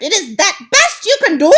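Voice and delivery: very high, loud, scornful screech; a witch queen scolds her coven; witch cries out. Acted. Witch continues mocking.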